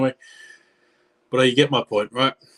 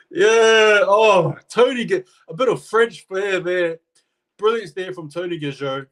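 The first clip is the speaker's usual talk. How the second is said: higher, loud and buzzing; elated; 9 semitones higher, 6 dB louder than usual. Real, not acted. Commentator praising Gajot's try, elated and high. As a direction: still riding the thrill of the try.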